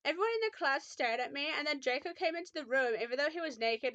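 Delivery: usual speaking voice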